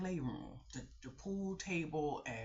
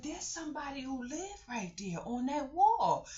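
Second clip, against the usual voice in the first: put-on high teasing voice for a kid; high child-directed tease; playful child talk; 11 semitones higher, 4 dB louder than usual. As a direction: tease the little girl gently. Talking sweetly to little kids.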